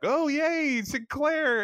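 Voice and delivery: imitating thrilled Zach. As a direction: squeal his name like a starstruck fan. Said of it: "Zach is like".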